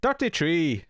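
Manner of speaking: "Dirty tree" for thirty-three in a hammed-up Irish brogue; mock Irish counting; mock Irish accent. Shown as a demonstration. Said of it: "That's thirty-three in Irish".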